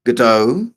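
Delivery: Godot as guh-DOH, stressed at the end; guh-DOH pronunciation. Shown as a demonstration. Listing valid ways to say it, Godot as guh-DOH. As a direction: shrink the first syllable of Godot, guh-DOH. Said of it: "however you wanna pronounce it".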